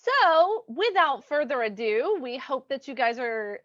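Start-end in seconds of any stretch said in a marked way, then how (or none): none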